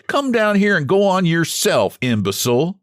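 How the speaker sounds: little man snapping back at the pudgy man; angry retort. A narrator's reading. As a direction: fling the insult back up the slope. Angry quarrel in a cave.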